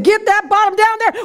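high-pitched, hollered command; stern principal's order. Retold or imitated. Principal orders pupil down for swats, yelled command. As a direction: imitate a principal yelling an order.

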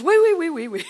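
high, loud squealing wee sounds; whiny squeal. Retold or imitated. Husband squealing in protest at his wife's plan, high and loud. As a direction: squeal like a cornered piglet. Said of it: "He goes".

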